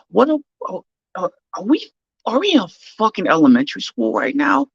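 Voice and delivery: high, exasperated disbelief; can't believe what he's watching; incredulous rant. Real, not acted. Mocking the host's emotional outburst.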